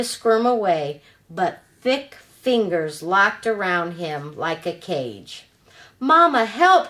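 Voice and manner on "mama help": higher, louder cry for help; frightened cry. Acted. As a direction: call out for mama in panic, loud and high. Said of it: "cried Bill".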